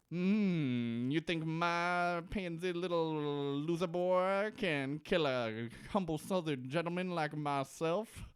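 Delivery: lightly put-on Southern accent, slow and smug; a hint of a Southern con man sneering at his son; Southern drawl, sly and unhurried; [name]'s southern drawl. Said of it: "his, like, southern drawl is, like, all the way in".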